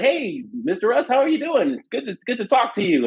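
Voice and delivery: high, overly polite fan greeting; forced composure. Retold or imitated. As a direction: hold in the excitement and sound casual. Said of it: "I took all of me to suppress that and be like".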